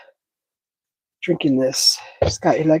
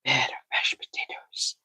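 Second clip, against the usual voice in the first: much softer, lower, an unvoiced whisper; whispered echo; 6 semitones lower, 13 dB quieter than usual. Real, not acted. Cook dreaming aloud about his plate, in a whisper. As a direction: savour the words under the breath.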